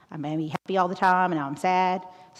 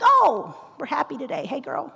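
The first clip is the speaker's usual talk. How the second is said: put-on much higher, bubbly hey girl; excited reply; 8 semitones higher, 4 dB louder than usual. Answering her happy best friend in an excited high voice. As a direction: gush back at her friend.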